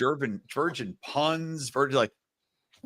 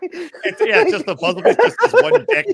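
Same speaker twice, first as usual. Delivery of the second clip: high, loud laughter through words; cracking up mid-sentence; laughing; 9 dB louder than usual. Real, not acted. Cracking up at the logo.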